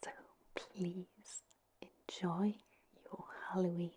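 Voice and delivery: ordinary delivery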